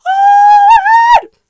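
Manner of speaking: very high, loud, drawn-out shriek; acted-out delighted scream; overjoyed squeal. Overjoyed reaction to clinging toddler.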